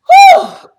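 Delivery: high, loud exhale of relief; loud whew. Real, not acted. Vlogger finishing a rant, a loud high whew. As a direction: blow it all out.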